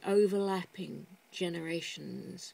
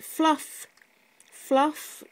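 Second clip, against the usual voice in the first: "Fluff" said high and loud, relishing the word; savouring the word; 7 semitones higher, 7 dB louder than usual. Shown as a demonstration. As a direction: lean into "Fluff", showing the word off. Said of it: "Do you have that word".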